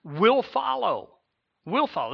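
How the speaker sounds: will follow stretched slow, will leaping high and loud; emphatic stress. Shown as a demonstration. Preacher stressing to the church that persecution will follow, slow and high. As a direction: press down hard on will, then say will follow again. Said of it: "This is in the emphatic".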